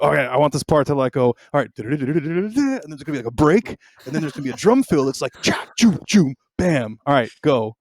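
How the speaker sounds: goofy voice memo, beatboxing the drums; hammy goofy vocal drum demo. Retold or imitated. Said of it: "my goofy voice messages".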